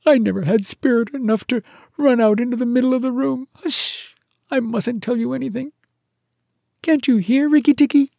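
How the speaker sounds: high, loud, panicky little voice; tearful timid muskrat. Acted. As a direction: whimper it high, with an urgent hush.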